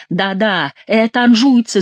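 mild shout, rising to the accusation. A narrator's reading. Favourite blaming the Angevins among the outcry, shouting. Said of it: "закричал Келюс".

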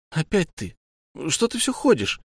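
sharp annoyed high outburst; irritated. Acted. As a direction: snap at him, fed up. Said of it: "Татьяна разозлилась".

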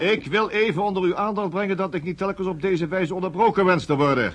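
furious interrogator scolding the interrupting suspect; angry rebuke. Acted. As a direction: slap down the interruptions, loud and sharp.

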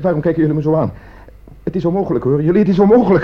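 frantic, cracking protest; panicked denial. Acted. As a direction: insist desperately it can't be.

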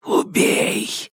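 unvoiced hiss from the dark; hissing darkness. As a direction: hiss it like a shadow. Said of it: "прошипела тьма".

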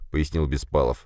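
usual speaking voice

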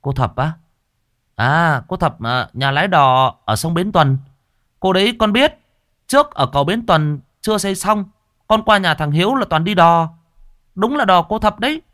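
high, loud, lively answer; high-pitched eager young man; eager young disciple. Acted. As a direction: pipe up excitedly with what you know. Tiến eagerly answers his master.

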